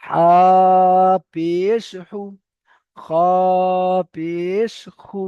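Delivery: very slow chant with long held vowels; drawn-out recitation. Shown as a demonstration. Teacher demonstrates the required stretch, slow and sustained. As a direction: hold each letter name long before its syllable. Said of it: "এমনভাবে পড়তে হবে".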